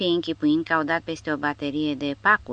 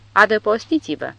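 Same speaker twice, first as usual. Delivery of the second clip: barked order to take cover, a bit louder; commanding shout; 4 semitones higher, 5 dB louder than usual. A narrator's reading. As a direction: order the men down fast. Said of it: "comandă bătrânul".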